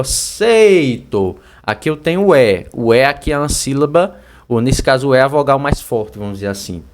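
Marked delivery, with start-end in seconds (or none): 0.0-1.4 SEITO very drawn out on the "ei"; slow, emphatic seito; isolated diphthong demo. Shown as a demonstration. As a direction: stretch seito slowly so the E-I glide is heard. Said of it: "Você vê que aqui".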